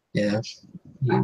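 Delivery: usual speaking voice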